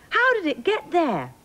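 high, amazed storybook king; astonished question. Acted. King astonished to see sunflower.

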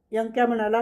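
usual speaking voice

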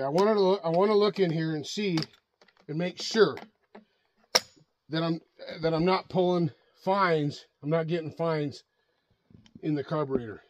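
ordinary talk

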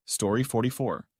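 ordinary delivery, nothing marked

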